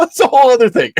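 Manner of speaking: laughing. Real, not acted. Laughing at his own tangent.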